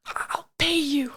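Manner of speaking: slow, drawn-out secret whisper. Acted. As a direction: whisper a bribe across the yard. Player secretly offers a bribe.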